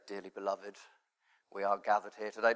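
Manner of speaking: dreary minister impression. Shown as a demonstration. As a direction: put on a dreary, miserable vicar voice. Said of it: "like, a dreary, miserable voice".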